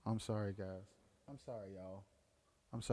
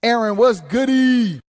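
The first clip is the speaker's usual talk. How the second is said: loud greeting; 10 semitones higher, 17 dB louder than usual. Real, not acted. Greeting a viewer on stream.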